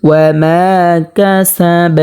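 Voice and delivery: recited ওয়া মা with মা held one beat; slow recitation stretching মা slightly; one-count elongation. Shown as a demonstration. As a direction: lengthen the vowel of মা briefly before কাসাব. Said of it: "এক আলিফ পরিমাণ টানিয়ে পড়তে হবে".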